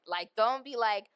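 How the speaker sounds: ordinary talk, nothing marked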